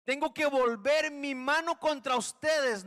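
a stern God threatening judgment. Acted. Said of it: "nos dijo el Señor".

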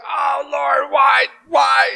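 hammed-up whiny cry to the Lord; whining Joshua. Preacher playing Joshua complaining to God, whining. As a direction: pout and whine it out, mock distress. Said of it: "he's pouting, he's whining".

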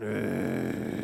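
low grudging ugh; dog's disgusted groan. Pretend. Obedient dog resenting its owner, low disgusted groan. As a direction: sullen groan of a forced dog. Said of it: "it's just going".

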